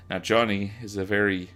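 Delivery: ordinary delivery, nothing marked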